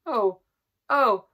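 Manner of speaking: put-on two girls crying out at the empty table; anguished cry. A narrator's reading. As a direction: cry out in shock and grief. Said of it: "cried the two girls".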